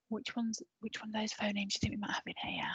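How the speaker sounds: quick hushed question; whisper. Real, not acted. Teacher whispering the question to her pupils. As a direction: rattle it off in a whisper.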